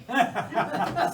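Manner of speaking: loud burst of real laughter; sheepish laugh. Real, not acted. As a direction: laugh at getting worked up. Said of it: "I'm getting worked up".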